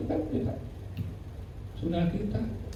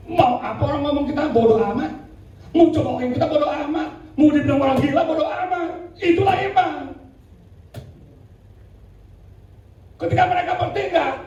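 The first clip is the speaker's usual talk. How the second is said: fiery preaching; 7 semitones higher than usual. Acted. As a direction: preach it out with rising fervor. Preaching at full force.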